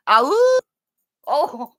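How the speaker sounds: ordinary talk, nothing marked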